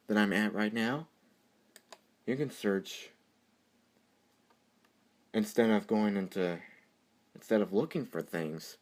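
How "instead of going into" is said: ordinary delivery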